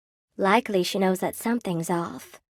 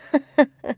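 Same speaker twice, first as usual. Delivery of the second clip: high chuckle; 5 semitones higher than usual. Acted. Character laughs at own joke.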